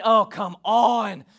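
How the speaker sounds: exasperated God. Pretend. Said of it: "He's not saying".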